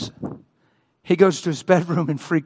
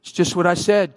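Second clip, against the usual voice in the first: ordinary delivery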